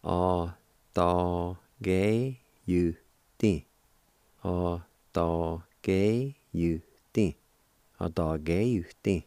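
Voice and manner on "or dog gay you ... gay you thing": slow demo. Shown as a demonstration. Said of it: "you can pronounce it like this".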